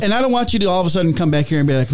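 normal talking voice

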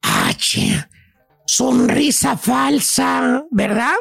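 mock-detective pondering, very slow; slow deduction. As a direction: stretch each word as if decoding a clue. Acting out mental deciphering of clues.

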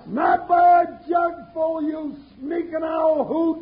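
high, slow yelled retort; defiant shout. Acted. Jingles yells defiance at Gadsden's gang, slow and high. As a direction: bellow it back at the gunmen outside. Yelling back at the attackers outside.

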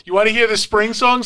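playful dad tease. Retold or imitated. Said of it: "I'm like".

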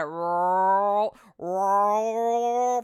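long drawn-out rumbling groan; imitated stomach rumble. Recounting an upset stomach after pasta, imitated rumbling. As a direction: mimic a churning stomach, stretched out slow.

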